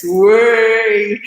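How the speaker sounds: drawn-out celebratory whoop; cheer. Real, not acted. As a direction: cheer it out and hold the vowel. Cheering a compliment from a viewer.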